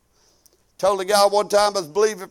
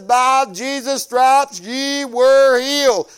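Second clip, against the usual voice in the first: preached scripture; 3 semitones higher, 4 dB louder, much slower than usual. Acted.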